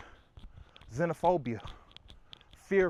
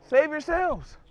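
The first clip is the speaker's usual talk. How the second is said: high, loud call to flee; mock panicked cry; 10 semitones higher, 7 dB louder, slower than usual.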